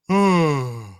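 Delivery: loud open yawn-sigh. Shown as a demonstration. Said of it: "I can maybe yawn".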